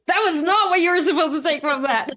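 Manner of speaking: high-pitched mock scolding through laughter; laughing exasperation. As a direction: scold them while cracking up. Retells scolding family, cracking up.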